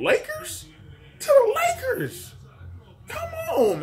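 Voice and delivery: high, slow, crying wail of despair; mock wail. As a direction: whine it like a heartbroken fan. Fan wailing over Lakers loss.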